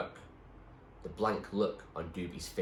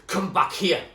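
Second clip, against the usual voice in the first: loud, high angry yell; 11 semitones higher, 10 dB louder than usual. A narrator's reading. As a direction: order him back from the shore. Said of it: "yelled Doobie from the shore".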